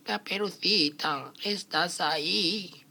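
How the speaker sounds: put-on high, slow old granny voice; wolf faking a frail grandmother; fake grandma voice. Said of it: "El lobo fingió la voz de la abuela".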